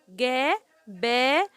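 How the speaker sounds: "جبل" very slowly, a long gap after each syllable; teacher sounding out "جبل". Shown as a demonstration. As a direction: break "جبل" into three separate, stretched sounds. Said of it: "كل حرف لوحده".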